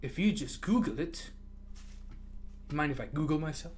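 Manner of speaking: normal talking voice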